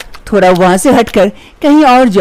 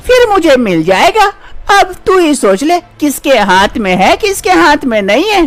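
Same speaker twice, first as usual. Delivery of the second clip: higher-pitched, taunting mother-in-law; nagging mother-in-law; 7 semitones higher than usual. Acted. Mother-in-law threatening her childless daughter-in-law, higher taunting voice. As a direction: needle the daughter-in-law as a scolding saas.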